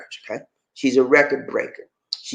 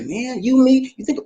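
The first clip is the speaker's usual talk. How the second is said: ordinary talk, nothing marked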